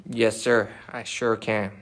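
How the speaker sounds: ordinary talk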